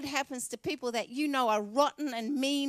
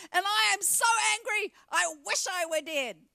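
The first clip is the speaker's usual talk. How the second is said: petulant, much higher and louder cry of anger; sulky Jonah; 9 semitones higher, 5 dB louder than usual. Acted. Jonah answering God, petulant angry whine. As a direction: whine like a furious sulking prophet. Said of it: "This is Jonah".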